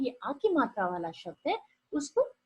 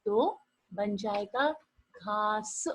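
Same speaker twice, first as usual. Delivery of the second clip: slowly sounded out; 4 dB quieter, much slower than usual. Shown as a demonstration. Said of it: "घ के बाद आ की आवाज".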